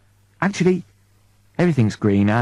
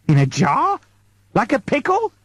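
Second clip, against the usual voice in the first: aghast, rising sharply; a jar, like a pickle, said in horror; incredulous; 9 semitones higher than usual. Acted. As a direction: pitch leaping up in disbelief on pickle. Eddie aghast at being pickled.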